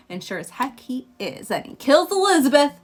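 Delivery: normal talking voice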